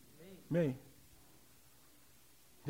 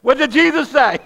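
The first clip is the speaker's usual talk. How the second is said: higher, much louder, Jesus stressed; loud preaching; 5 semitones higher, 14 dB louder than usual. Shown as a demonstration. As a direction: shout the question to rouse the church. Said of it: "I can't say it like this".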